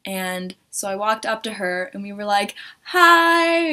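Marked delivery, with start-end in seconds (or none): 2.8-3.7 high, loud, drawn-out hi; mock fan greeting, pitched up; excited greeting. Fan greets her idol, excited high hi.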